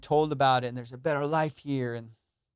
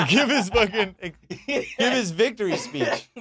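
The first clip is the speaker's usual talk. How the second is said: laughing; 6 semitones higher, 4 dB louder, slower than usual. Real, not acted. Laughing about Trump speech.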